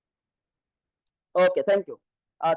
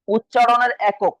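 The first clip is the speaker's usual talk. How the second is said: loud, slow and deliberate for note-takers; slow dictation; 2 semitones higher, 7 dB louder, slower than usual. Shown as a demonstration. Teacher dictating a definition to students, slow dictation. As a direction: dictate it slowly, raised voice.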